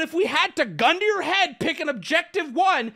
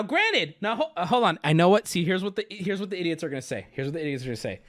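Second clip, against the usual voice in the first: normal talking voice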